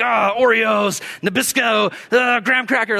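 high, mock-furious outbursts; angry grumbling. Angry shopper grabbing youth group supplies, mock fury. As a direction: snap out each item in exaggerated irritation. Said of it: "I'm just, like, angry".

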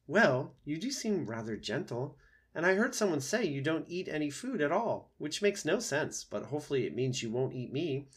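lightly voiced, chattery opossum; a hint of high nervous reasoning. Opossum hoping not to be eaten, mildly nervous.

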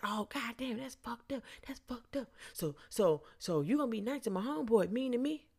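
high mock grievance; riled-up man. Rejected man hearing friend defend her, mock-aggrieved. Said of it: "now he's getting all riled up".

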